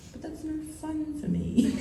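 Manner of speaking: high, soft mock disappointment; mock gutted. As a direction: whine it in a small, high voice with a laugh. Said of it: "so I was actually gutted".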